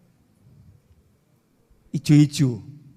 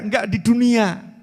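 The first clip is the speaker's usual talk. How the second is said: ordinary delivery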